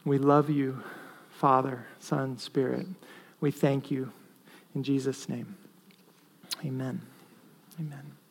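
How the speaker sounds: soft, hushed prayer ending in amen; closing prayer. Acted. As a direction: pray quietly to close.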